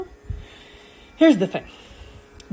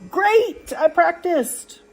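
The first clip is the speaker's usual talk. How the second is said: drawn-out, high, pleased exclamation; proud cheer; 11 semitones higher, much slower than usual. Acted. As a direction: celebrate day one's practice, voice jumping up. Voices day-one success in reading.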